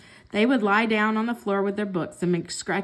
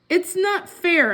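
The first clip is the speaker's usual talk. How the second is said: higher, slow whiny pout; 8 semitones higher, 4 dB louder, slower than usual. A narrator's reading. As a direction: sulk like a let-down kitten. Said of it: "I pouted".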